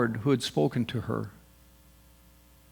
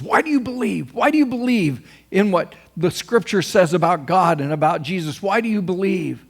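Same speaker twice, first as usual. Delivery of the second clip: high, louder, insistent challenge; challenging questioner; 5 semitones higher, 4 dB louder than usual. Acted. Somebody questioning a believer's faith, challenging. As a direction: fire it off as an insistent demand.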